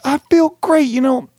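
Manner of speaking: high, elated post-game gush; imitating a thrilled player; overexcited athlete. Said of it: "he was so excited".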